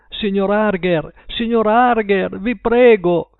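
desperate plea. A narrator's reading. Terrified Paul begging Mr Harger through the wall. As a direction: beg them, voice climbing. Trembling boy begging neighbours.

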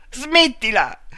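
sharp shouted stop. A narrator's reading. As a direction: yell it to cut him off. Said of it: "urlò lei". (grido secco di lei)

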